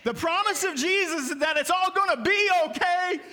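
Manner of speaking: rising pulpit fervor; preaching. Acted. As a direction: proclaim the promise to the church. Preaching climax.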